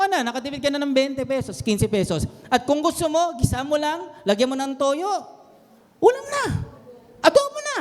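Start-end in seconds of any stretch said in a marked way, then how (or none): 6.0-7.8 high, bright punchline pop; excited exclamation. Acted. Pastor delighting the congregation with a cheap meal, exclaimed high. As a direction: sell it like a triumphant reveal.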